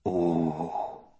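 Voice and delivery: soft, low awed Welsh sigh; wonder. Acted. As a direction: breathe it out in wonder. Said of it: "wonder in his voice".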